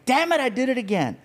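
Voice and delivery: high, exasperated self-scolding; frustrated. Retold or imitated. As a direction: kick yourself for missing out. Retold own frustration.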